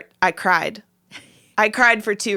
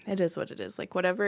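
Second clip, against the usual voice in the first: soft, offhand acceptance; resigned shrug; 10 dB quieter, faster than usual. Retold or imitated. Wife after gathering her feelings, resigned shrug. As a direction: let it go quietly.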